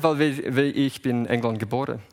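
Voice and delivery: ordinary talk, nothing marked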